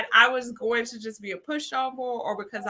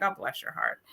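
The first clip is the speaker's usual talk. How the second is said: sweet sarcasm; 3 semitones lower, 9 dB quieter than usual. Retold or imitated. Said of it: "I'm like".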